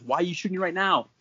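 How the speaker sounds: high, quick and incredulous; acted exasperated question. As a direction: rattle off his bewildered complaint. Buddy's outburst continues in retelling.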